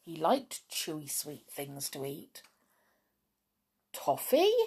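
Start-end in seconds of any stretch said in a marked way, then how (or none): 3.9-4.5 high, questioning surprise; puzzled storybook echo, pitched right up; surprised. A narrator's reading. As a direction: repeat it as a baffled question. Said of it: "said everyone in surprise".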